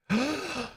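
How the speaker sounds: sharp, very high gasp of joy; delighted gasp. Real, not acted. Spotting missing viewers in the chat, a delighted gasp.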